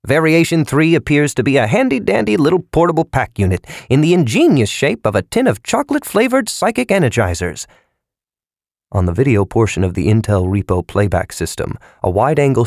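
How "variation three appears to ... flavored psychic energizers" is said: vigorous salesman. A narrator's reading. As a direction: pitch it with gusto. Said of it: "answered vigorously".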